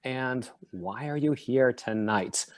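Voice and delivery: ordinary talk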